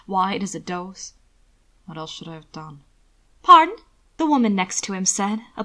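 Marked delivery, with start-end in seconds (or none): 1.8-2.8 low, hushed self-question; a soft, much quieter private question; quiet inner thought. Acted. 3.4-3.8 loud, high startled "Pardon?"; a sudden sharp high query; the society woman jolting in, loud and high; surprised pardon. Acted. Woman interrupts him.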